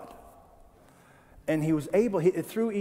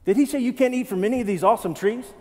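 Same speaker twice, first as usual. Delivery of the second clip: fast, higher mocking; mock serpent; 5 semitones higher, faster than usual. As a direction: tease it like a snide salesman.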